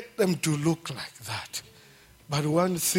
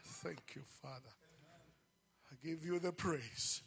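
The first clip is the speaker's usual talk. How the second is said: very soft, murmured thanks to God; a near-silent private prayer; hushed prayer; 3 semitones lower, 8 dB quieter than usual. Real, not acted. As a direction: drop almost to nothing and pray. Quiet prayer after preaching.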